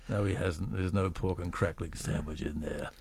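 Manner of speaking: deflated admission. Real, not acted. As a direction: drop the act in a low, quiet letdown. Host drops playful pretence about sandwich.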